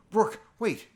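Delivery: loud, high shout to stop someone; urgent call. Acted. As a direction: call out sharply to halt a friend. Friend tries to stop Rourke.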